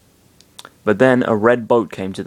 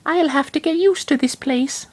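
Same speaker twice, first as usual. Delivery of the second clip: put-on glum girl. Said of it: "sighed in depression".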